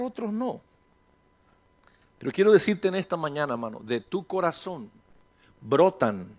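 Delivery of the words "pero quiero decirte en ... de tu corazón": ordinary talk, nothing marked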